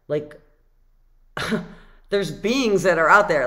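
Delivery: ordinary delivery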